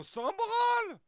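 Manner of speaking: very high, full-volume drawn-out yell; exasperated shout. Acted. Teammate yelling back at the character fussing over dung, exasperated shout. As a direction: holler it, dragging out the last word.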